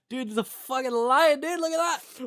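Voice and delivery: high, loud awestruck yelling; excited tourist. Acted. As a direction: gawk and holler like a thrilled tourist. Acted awestruck safari tourists.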